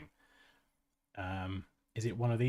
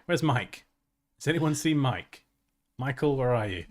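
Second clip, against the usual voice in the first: loud, raised-pitch call for someone missing; 4 semitones higher, 10 dB louder than usual. Real, not acted. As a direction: call across the room looking for someone. Calling out for a missing viewer.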